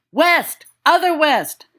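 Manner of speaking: high, pressing shout of West; urgent correction. Acted. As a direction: insist on the other direction. James correcting direction, urgent.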